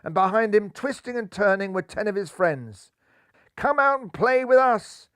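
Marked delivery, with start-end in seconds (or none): 3.6-5.1 high, louder call across the waves. A narrator's reading. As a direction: call them to come and play. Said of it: "called Dolly Vera the dolphin".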